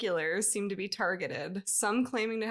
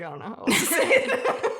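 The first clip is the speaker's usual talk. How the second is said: laughing; 8 dB louder than usual. Retold or imitated. Laughing while quoting self.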